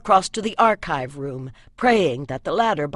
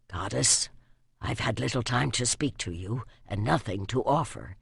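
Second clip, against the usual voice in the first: soft, low hushed prayer to the goddess; whispered prayer; 7 semitones lower, 7 dB quieter than usual. A narrator's reading. Archer bidding his goddess farewell, hushed and low. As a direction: murmur it gently, almost a whisper. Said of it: "he whispered".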